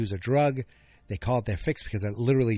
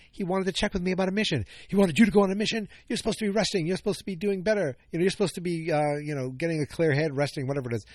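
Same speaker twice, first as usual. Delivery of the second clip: lightly voiced Bix, higher and a bit fast; 7 semitones higher, faster than usual. Bix scolding Andor about resting, in a higher pleading voice. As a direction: play Bix pleading with him, pitched up. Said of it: "She's like".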